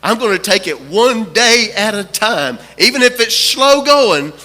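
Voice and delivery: rousing sermon climax, driving each phrase home; impassioned preaching. Retold or imitated. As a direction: preach it with rising resolve.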